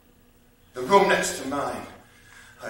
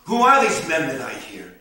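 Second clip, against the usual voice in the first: ordinary delivery, nothing marked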